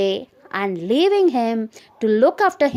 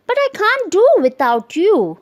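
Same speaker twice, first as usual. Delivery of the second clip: high, louder dismayed plea; alarmed; 8 semitones higher, 5 dB louder than usual. Acted. Husband begs wife not to go, high alarmed plea. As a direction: whine it, suddenly lost without her. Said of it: "quite alarmed at being left".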